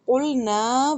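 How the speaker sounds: قُلْنَا with the final نا held two counts; slow correct qulna. Shown as a demonstration. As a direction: draw out the نا of قُلْنَا slowly. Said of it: "دو حرکہ کر کے پڑھنا ہے".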